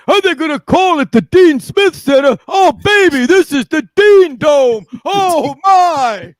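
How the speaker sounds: high, loud, over-the-top sportscaster; Dick Vitale. Retold or imitated. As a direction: boom it out like an excitable basketball announcer. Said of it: "Dick Vitale. Remember people used to call him that?".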